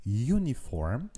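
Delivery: uniform said as "you-niform"; slow, careful you-niform; pronounced with a Y sound. Shown as a demonstration. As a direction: start uniform with a clear y glide. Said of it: "on voit à l'écrit que le mot commence par une voyelle".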